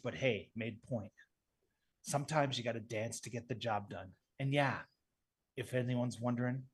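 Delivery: low, soft, terse tough guy; Jason. Acted. Bachelor Jason answering the question, low and stoic. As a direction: keep it low and unimpressed.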